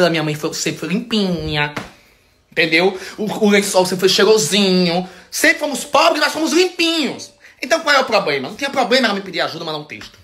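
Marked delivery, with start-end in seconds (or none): none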